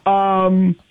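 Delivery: tinny phone-line sound; telephone voice. Real, not acted. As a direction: heard through a telephone. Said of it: "if I sound like I'm on a telephone".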